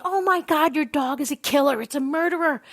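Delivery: mock horrified scream. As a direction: imitate horrified onlookers, pitch way up. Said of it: "the girls behind me start screaming".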